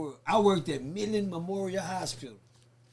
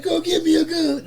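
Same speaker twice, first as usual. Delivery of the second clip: acted partner; 10 semitones higher, 7 dB louder than usual. Partner's demand, acted in story.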